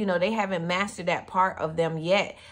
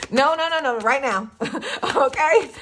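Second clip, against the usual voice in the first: higher, louder no no no; emphatic insistence; 5 semitones higher, 6 dB louder than usual. Real, not acted. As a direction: push it hard and laughing, no debate. Insisting someone must leave now.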